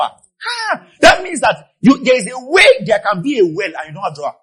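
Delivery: high, loud sermon cry; impassioned preaching. Real, not acted. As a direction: proclaim it to the whole congregation. Preaches at full force.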